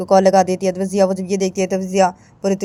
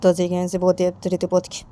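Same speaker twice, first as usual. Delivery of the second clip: plain reading voice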